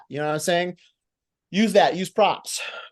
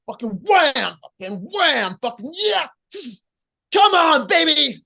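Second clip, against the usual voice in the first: high explosive wrestling yells; fired up; 9 semitones higher, 4 dB louder than usual. Shown as a demonstration. Coach demonstrating a babyface fire-up, high and explosive. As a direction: fire yourself up, building to a high shout. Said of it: "that's kinda like the fire up".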